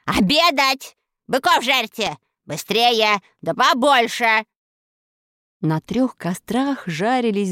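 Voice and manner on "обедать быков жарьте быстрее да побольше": loud, high imperious command; commanding shout. A narrator's reading. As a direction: bark orders at the servants. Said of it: "повелительно крикнула".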